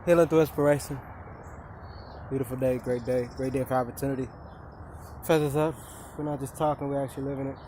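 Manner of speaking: soft, low sign-on blessing; calm affirmation. Acted. Vlogger greeting viewers, soft calm affirmation voice. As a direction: ease into it like a mantra.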